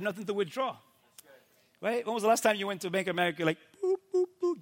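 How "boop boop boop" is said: high-pitched "boop" button noises; imitated keypad beeps. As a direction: mimic pressing cash machine keys. Imitating cash machine button beeps.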